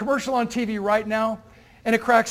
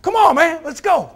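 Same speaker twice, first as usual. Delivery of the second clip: louder, higher mock pep shout; rallying call; 7 semitones higher, 9 dB louder than usual. Friend hyping a depressed buddy, loud rallying call. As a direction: fire up a friend. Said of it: "people and say".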